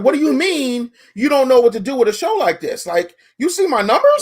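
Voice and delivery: baffled, demanding an answer; confused. Retold or imitated. Confused host retorting to the buyer. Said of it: "I was confused".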